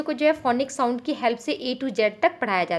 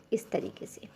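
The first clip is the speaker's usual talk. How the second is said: whisper; 4 semitones lower, 14 dB quieter than usual. Real, not acted. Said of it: "whispered aside".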